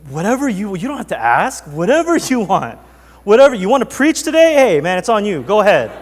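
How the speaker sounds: eager to please. Retold or imitated. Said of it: "eager reply to Kobe".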